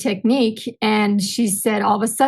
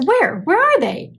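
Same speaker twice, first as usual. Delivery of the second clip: puzzled husband; 8 semitones higher than usual. Retold or imitated. Wife voices confused husband.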